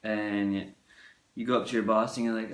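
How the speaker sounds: usual speaking voice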